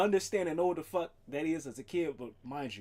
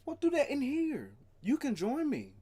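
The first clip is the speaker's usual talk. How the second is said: talking to the cat; 10 semitones higher, 5 dB louder than usual. Real, not acted.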